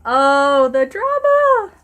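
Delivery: high, loud, drawn-out theatrical wail; mock melodrama. Reader mocking Draco crying in a corner, hammed-up melodrama. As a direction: swoon over it with hammed-up despair.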